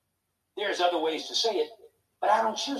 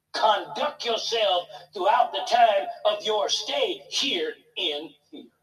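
preaching at full force; 4 semitones higher, 4 dB louder, slower than usual. Acted. Preaching scripture with rising force.

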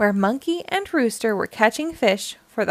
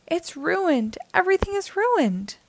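higher, upset wail, a little softer; cried out; 8 semitones higher, 3 dB quieter than usual. A narrator's reading. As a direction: lament that all is spoiled, pitched up. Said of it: "cried Ruby".

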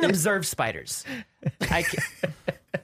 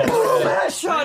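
much higher and louder, laughing game callout; mock headshot shout; 12 semitones higher, 6 dB louder, slower than usual. Retelling the library fall to friends, laughing mock shout. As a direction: shout it like a sniper scored a hit. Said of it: "'Cause I was like".

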